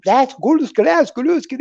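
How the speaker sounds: high, loud Russian gabbing; mock Russian. Imitating a Russian woman gabbing down the hall, mock Russian. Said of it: "in Russian".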